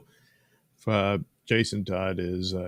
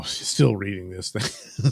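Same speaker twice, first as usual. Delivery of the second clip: ordinary talk, nothing marked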